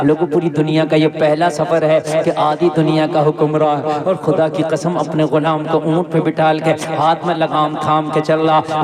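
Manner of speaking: usual speaking voice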